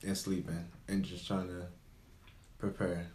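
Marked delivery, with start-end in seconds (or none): none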